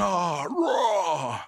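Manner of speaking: pained cry. Retold or imitated. Throws bucket, pulls back muscle.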